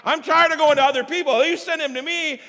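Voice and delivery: loud, high indignant protest; angry refusal to God. Retold or imitated. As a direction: shout back at God, fed up. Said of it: "I'm like".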